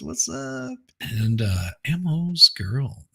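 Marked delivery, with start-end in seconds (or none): 0.0-0.8 squeaky greeting. Real, not acted. Greeting a viewer in chat.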